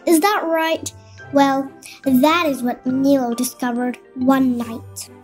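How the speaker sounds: ordinary delivery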